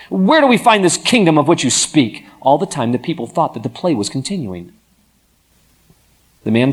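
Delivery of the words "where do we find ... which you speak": high, loud demand in protest. A narrator's reading. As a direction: challenge him, protesting. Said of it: "demanded in protest".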